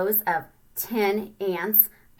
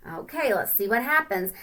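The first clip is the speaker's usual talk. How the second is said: ordinary delivery, nothing marked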